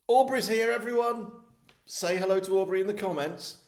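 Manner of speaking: high, loud call to everyone; excited announcement. Real, not acted. Host announces a viewer's arrival to everyone, excited announcement. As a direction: announce it loudly, pitched well up. Calling out to the live audience.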